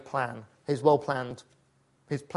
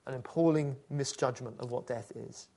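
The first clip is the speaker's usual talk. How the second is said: ordinary delivery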